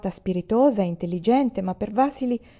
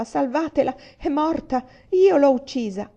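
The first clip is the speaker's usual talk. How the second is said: high, desperate cry building louder; sobbing plea; 6 semitones higher, 5 dB louder than usual. A narrator's reading. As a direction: plead in narrated anguish.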